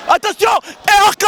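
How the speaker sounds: high shouted warning; high, urgent commentator shout; excited alarm. Real, not acted. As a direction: yell out the move name. Sudden move warning.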